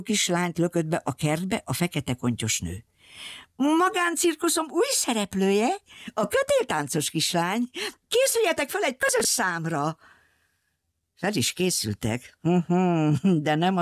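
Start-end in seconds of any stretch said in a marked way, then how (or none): 3.6-10.0 showy villainess. Acted. Cruel circus owner presents the girl to her captives, higher showy voice. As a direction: announce the new act like a proud circus owner.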